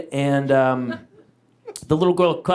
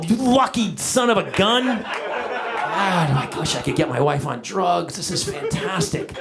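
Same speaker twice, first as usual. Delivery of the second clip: mock envious buddy; slower than usual. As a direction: play a jealous husband, half laughing. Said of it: "I go".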